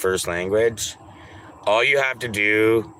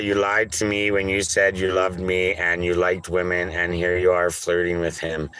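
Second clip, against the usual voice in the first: ordinary talk, nothing marked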